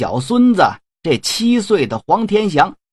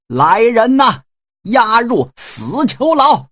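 commanding call; 6 semitones higher than usual. A narrator's reading. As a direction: call the guards in, stretched and slow. Marshal calls guards to jail him. (元帅高声喝令来人)

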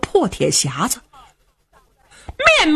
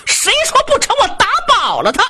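defiant shout; 13 semitones higher than usual. Acted. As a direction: throw it back in his face, yelling. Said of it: "这小伙子冲他爹一瞪眼".

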